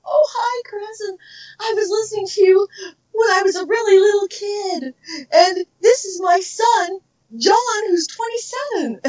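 high-pitched mock fan greeting; gushing fan. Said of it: "they go".

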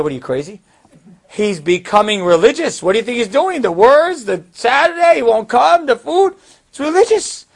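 rising, loud, excited listing; exasperated insistence. Retold or imitated. Mother at dinner insisting to father, rising excited exasperation.

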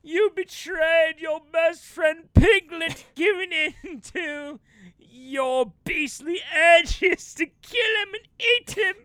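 high, slow, loud stage reproach; over-exaggerated reaction. Actor scolding the bear for eating Piglet, hammy anguish. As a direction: declaim the accusation with mock tragic weight. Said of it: "completely over-exaggerate his whole reaction".

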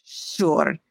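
put-on hesitant Slavic-accented assent; perplexed, Slavic accent. A narrator's reading. Puzzled old baker agrees to show, Slavic accent. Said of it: "She looked perplexed".

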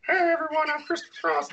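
mock singer talking in a very high voice; high falsetto hello, hammed up. Host mocking singers who don't talk as they sing, high put-on voice. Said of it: "[name] goes around like".

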